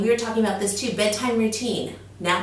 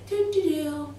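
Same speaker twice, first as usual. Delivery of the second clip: put-on lollygagging baby; 7 semitones higher, 3 dB quieter than usual. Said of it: "like a lollygager".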